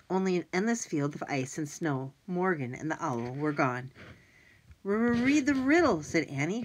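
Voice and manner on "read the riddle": stuttering through chattering teeth. Acted. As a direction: shiver the words out, slow. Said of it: "her teeth chattering".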